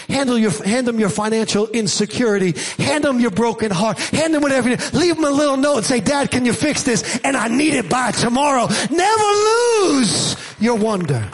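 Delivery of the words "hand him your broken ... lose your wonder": high-pitched urgent preaching, falling at the close; fervent preaching climax. Real, not acted. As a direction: build to a soaring plea, then drop.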